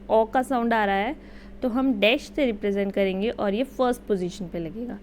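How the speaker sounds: usual speaking voice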